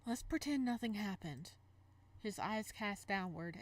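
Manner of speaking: measured romance read-aloud; story narration. Acted. Gamer reading a romance game's text aloud, narration. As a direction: narrate the guilt, then confront him. Reads visual novel aloud.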